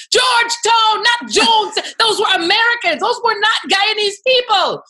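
high, loud indignant mother; mother's indignant retort. Retold or imitated. Mother correcting people who mistook her hometown, high loud indignation. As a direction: snap back like an offended mother. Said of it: "She used to get so pissed".

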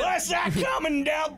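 high, drawn-out mock holler; game-day holler. Fan after a few pops, drawn-out high holler. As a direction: stretch out a high rowdy yell.

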